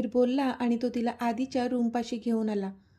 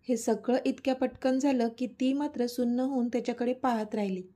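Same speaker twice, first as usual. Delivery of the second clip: ordinary delivery, nothing marked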